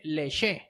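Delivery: "leche" said slowly with a soft French sh sound, as "LE-she"; mock French "leche", drawn out; French pronunciation. Shown as a demonstration. Teasing his co-host, says "leche" the French way, slowly. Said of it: "En francés no se dice leche, se dice".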